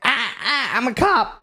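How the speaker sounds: high-pitched frightened stammer; comic high yelp of self-defense; mock panicked plea. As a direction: imitate a friend squealing at gunpoint. Friend pleading at gunpoint.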